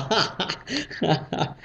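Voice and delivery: quiet breathy chuckle; soft laugh. Real, not acted. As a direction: laugh softly to yourself. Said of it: "简直笑死我了".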